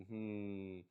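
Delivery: usual speaking voice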